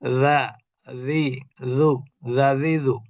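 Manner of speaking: রা, রি, রু said thin from the tongue tip; zal pronunciation drill. Shown as a demonstration. Teacher drilling the letter zal for students. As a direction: drill রা, রি, রু one by one. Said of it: "জালের উচ্চারণ কিভাবে হবে?".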